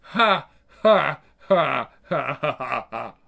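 loud derisive laugh fading out; scornful cackle that dies down; mocking laughter. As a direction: laugh in their face.

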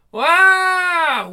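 loud, high wailing cry; piercing character shriek; acted scream. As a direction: let out a sudden high yell. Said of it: "Is someone screaming?".

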